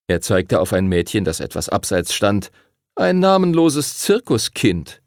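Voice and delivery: mocking bully. A narrator's reading. Timothy the bully mocks the new group, sneering. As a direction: poke fun at everyone in turn.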